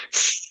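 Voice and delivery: long hissing s sound; drawn-out s like a snake. Shown as a demonstration. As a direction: hiss it out like a snake. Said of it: "a snake sound".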